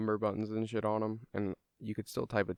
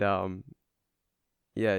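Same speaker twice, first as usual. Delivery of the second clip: ordinary talk, nothing marked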